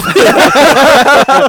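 loud high burst of laughter; big laugh. Real, not acted. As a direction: crack up laughing. Laughing at joke.

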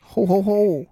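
loud, high hammed-up Santa "Ho, ho, ho"; Santa laugh. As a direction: boom it out like a mock Santa. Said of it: "are you Santa".

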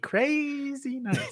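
high, slow, sing-song Sandler voice; Adam Sandler impression. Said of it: "That was my, that was my Adam Sandler".

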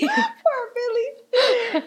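very slow high laugh-cry; stretched laughing wail. Real, not acted. Mock mourning while laughing.

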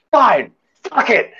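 high, slow, exasperated imitation; mock angry outburst. Angry lady gives up after security's reply, mock outburst. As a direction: squeal it out in defeated fury, played up. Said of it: "she started screaming".

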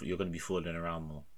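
usual speaking voice

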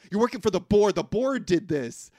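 mock paranoid outburst about the board; paranoid accusation. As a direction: hiss out a conspiracy like a paranoid man. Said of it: "Tom Cruise is like".

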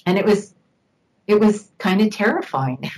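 ordinary talk, nothing marked

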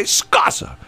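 very high, squawked comeback; heated retort. Retold or imitated. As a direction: fire it back in a mock marital fight. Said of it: "and the other would say".

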